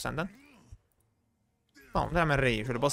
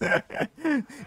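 high giggle; 8 semitones higher than usual. Real, not acted. Losing badly, zero and six.

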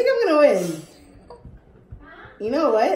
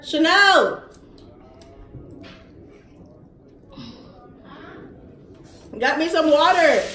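shouting; 6 semitones higher, 3 dB louder than usual. Real, not acted. Yelling for water, louder.

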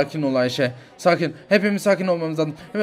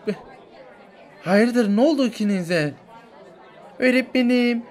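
ordinary talk

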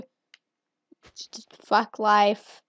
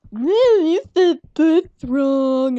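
mocking taunt; 6 semitones higher, 8 dB louder, much slower than usual. Mocking Xbox friends' correction.

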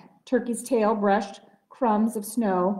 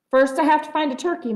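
loud, fast bellow, pitched up; bellowing back; 6 semitones higher, 5 dB louder, much faster than usual. A narrator's reading. Moose bellowing back at Sheep, loud and fast. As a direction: shout the answer right back, quickly. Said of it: "Mister Moose bellowed back".

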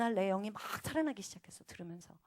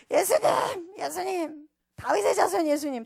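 full-voiced, high re-enacted shout; loud crying out; 9 semitones higher, 10 dB louder, slower than usual. Woman alone in hotel room calling to Jesus, shouting. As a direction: yell to heaven for attention. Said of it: "소리를 막 지르기 시작해서".

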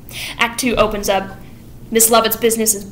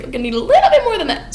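much higher and fast, mocking; sarcastic exasperation; 12 semitones higher, much faster than usual. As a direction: rattle it off with sarcastic disbelief. Sweeney exasperated with Anthony.

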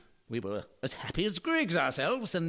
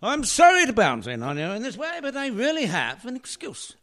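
loud, hearty, high-spirited entrance; merry; 4 semitones higher, 7 dB louder than usual. Acted. Dirth bursting in on the household, loud and merry. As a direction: burst in cheerfully, full voice. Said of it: "he sounds quite merry".

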